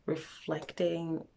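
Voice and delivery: usual speaking voice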